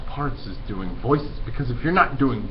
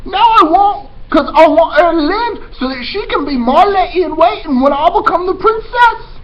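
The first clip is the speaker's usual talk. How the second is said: put-on high, loud, brash girl voice; eager Mig; 10 dB louder than usual. Teacher voicing dim servant girl Mig, high and loud. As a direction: pitch way up and blurt it out loud. Mig dreaming of being princess.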